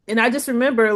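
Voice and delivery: normal talking voice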